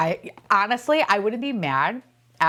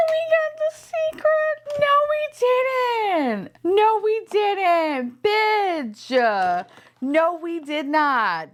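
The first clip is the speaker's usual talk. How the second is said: high squeal sliding into drawn-out wailing; shrieking excitement fading to whiny denial; hysterical wail; 12 semitones higher, much slower than usual. Real, not acted. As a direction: wail each word long, coming down gradually. Mistaken secret-figure reveal.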